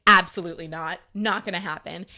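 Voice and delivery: firm refusal, punched at the start; retold loud refusal. Refusing to go behind the church, said out loud. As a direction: hit the first word loud and firm. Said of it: "said it out loud".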